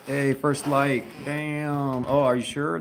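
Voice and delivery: ordinary delivery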